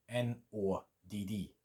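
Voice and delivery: ordinary talk, nothing marked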